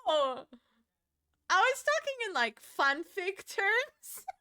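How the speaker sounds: high, loud squeaky excuse, drawn out; mock defensive. Applicant explaining herself at the citizenship interview, mock defensive. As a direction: plead it high and slow, playing caught out.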